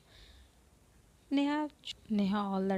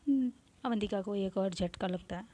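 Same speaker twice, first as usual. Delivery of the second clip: ordinary delivery, nothing marked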